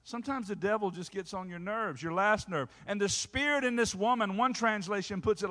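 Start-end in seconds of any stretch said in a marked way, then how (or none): none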